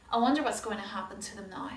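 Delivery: plain reading voice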